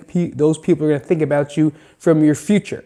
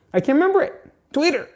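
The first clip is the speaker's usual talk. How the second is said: high squeak; 13 semitones higher, much faster than usual. Real, not acted. Frustrated squeak mid-ramble.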